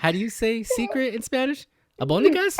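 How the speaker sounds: high, put-on silly voice; mock goofy voice. Imitating his friend's silly Spanish question in a high put-on voice. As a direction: ham up a clueless friend asking about Spanish. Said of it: "He's like".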